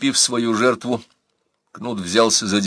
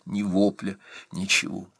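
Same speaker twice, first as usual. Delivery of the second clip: ordinary delivery, nothing marked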